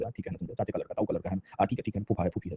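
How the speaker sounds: low, soft, dismissive mutter; a lightly voiced bored shrug at relatives; offhand indifference. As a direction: brush them off like distant cousins. Voicing distant paternal relatives.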